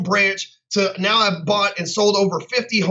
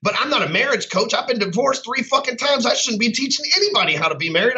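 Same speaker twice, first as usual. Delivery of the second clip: ordinary talk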